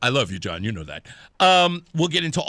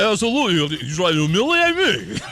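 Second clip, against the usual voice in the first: loud, garbled mock-Rocky slur; play-protest in a hammed-up boxer mumble; Sylvester Stallone; 4 semitones higher, 6 dB louder than usual. Host as Sylvester feigning offence to Mark, garbled Rocky impression. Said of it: "nobody has any idea what he's saying".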